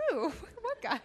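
laughing through an imitation of her friend's high puzzled "What guy?"; laughing mock friend. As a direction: voice the friend's disbelief while cracking up. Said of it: "And she's like".

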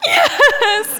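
high-pitched wailed yes; hammed-up tearful wail; mock sobbing. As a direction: squeal it out as if bawling. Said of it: "And I'm, like, sobbing".

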